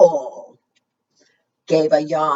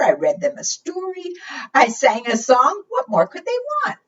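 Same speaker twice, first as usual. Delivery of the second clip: high, fast exasperated complaint; high, fast, mock-exasperated knight grumbling; 8 semitones higher than usual. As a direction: throw up your hands, baffled.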